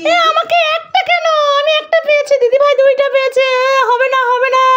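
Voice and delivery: put-on high, giggling kid protest; pouting toddler voice, laughing; whiny child. Parent voices child complaining.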